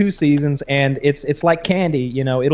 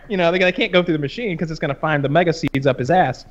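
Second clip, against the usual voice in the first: usual speaking voice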